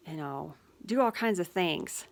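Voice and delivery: ordinary talk, nothing marked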